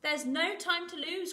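high, urgent chick plea; crying out. Acted. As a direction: cry it urgently as a little chick. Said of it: "cried Chicken Licken".

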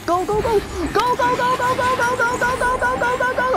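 high panicked go go go; frantic shouting. Real, not acted. Frantic escape in horror game.

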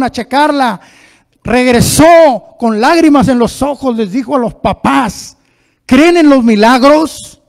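loud, high, slowed preaching; preaching at full force. Acted. Preacher tells the congregation of the birth, loud and high. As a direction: build the miracle story.

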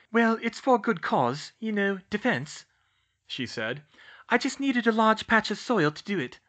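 put-on high, playful girl voice; young woman voice.

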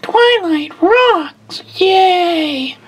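very high squeaky slow cheer; Fluttershy voice. Pretend. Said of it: "Andrea Libman is in the room".

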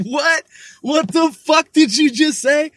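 high, loud stunned outburst; retelling his shock at hearing hecka; shocked disbelief. Retold or imitated. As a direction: blurt it out in total disbelief. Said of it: "And I was like".

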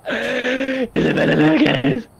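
mocking the robotic mic voice; slow imitating robot voice; robot voice imitation. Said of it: "He's running dial-up, boys".

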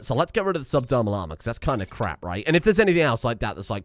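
normal talking voice

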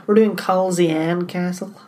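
put-on slow, drawn-out American accent; playing the tourist with an American accent. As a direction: say Culzean the American way, stretched. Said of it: "You can be more American".

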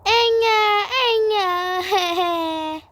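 put-on loud, high baby wail; drawn-out mock infant cry; pretend baby crying. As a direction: wail "enge" like a fussing baby. Baby wailing in the play.

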